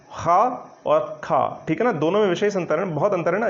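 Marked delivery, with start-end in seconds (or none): 0.1-1.6 खा and ख़ा said slowly side by side; slow contrast demo. Shown as a demonstration. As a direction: stretch each खा to show the difference. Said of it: "अंतर समझिएगा".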